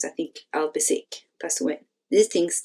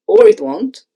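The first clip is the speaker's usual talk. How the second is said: sarcastic retort; 8 semitones higher, 10 dB louder than usual. Acted. Jen's sarcastic retort in group.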